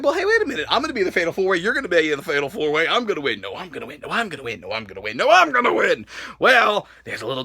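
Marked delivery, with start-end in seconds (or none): 0.0-6.1 quick muttered back-and-forth squabble; soft, low repetitive bickering; retold bickering. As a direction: mimic two rivals arguing under their breath. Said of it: "'cause he's like".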